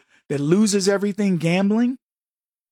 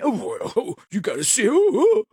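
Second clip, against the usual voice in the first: mocking overreaction; 4 semitones higher than usual. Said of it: "Something is mundane".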